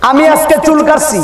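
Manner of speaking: high, loud, announcing; loud announcement. Acted. Preacher to the crowd, loud high announcement. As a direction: call it out high to the hall. Announces haircut, then repeats.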